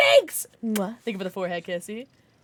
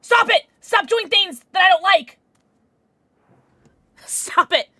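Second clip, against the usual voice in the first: louder, higher cry to stop; frustrated yell; 4 semitones higher, 9 dB louder than usual. Real, not acted. Yelling at the game to stop its moves. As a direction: shout him down in protest.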